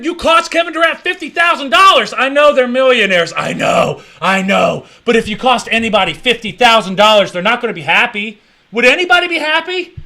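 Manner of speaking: high, loud heated argument; loud, high worked-up rant. Real, not acted. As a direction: argue it loudly. Heated rant about the fine.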